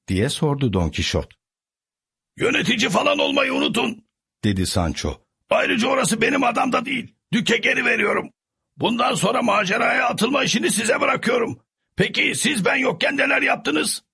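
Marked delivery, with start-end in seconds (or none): 2.4-14.0 high eager servant voice; cheerful Sancho. A narrator's reading. Sancho tells his master he quit, high and eager. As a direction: brush off the past, eager for news.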